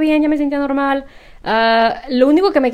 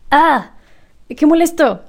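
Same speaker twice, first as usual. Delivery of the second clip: higher-pitched exasperated outburst; frustrated; 5 semitones higher, slower than usual. Real, not acted. Venting about constant throat clearing, frustrated. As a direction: let out the annoyance, then give in to a laugh. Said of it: "es tan frustrante querer hablar".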